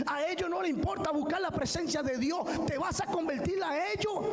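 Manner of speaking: high-pitched, fervent pulpit exhortation; impassioned preaching. Real, not acted. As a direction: challenge the congregation with urgent, high-pitched rhetorical questions. Preaches at full force. (predicación encendida, en tono muy agudo)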